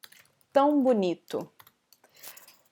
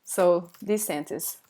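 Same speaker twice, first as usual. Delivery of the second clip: ordinary talk, nothing marked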